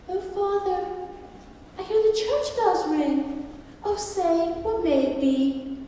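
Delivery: put-on high, girlish questioning voice; little daughter. A narrator's reading. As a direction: voice a little girl calling to her father, pitched well up. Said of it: "had taken his little daughter".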